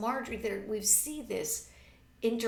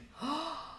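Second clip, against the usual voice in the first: gasp; 9 semitones higher than usual. Acted. Mother startled by remark.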